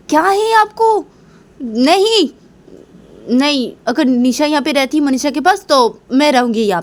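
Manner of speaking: high-pitched, agitated protest; acted huffy refusal; indignant objection. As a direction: object sharply, voice jumping up. Mother objects in acted quarrel.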